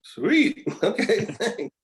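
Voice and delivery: a mock-gleeful high "Sweet"; delighted. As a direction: squeal it out like a kid getting a treat. Delighted at bonus remark.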